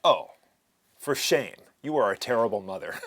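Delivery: loud teasing reproach; mock scolding.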